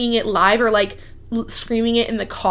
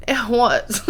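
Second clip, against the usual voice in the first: laughing. Real, not acted. Laughing at own embarrassing story.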